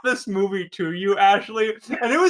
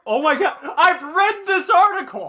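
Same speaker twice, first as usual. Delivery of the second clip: dawning joy; 8 semitones higher than usual. Real, not acted. Recognizing the article's author.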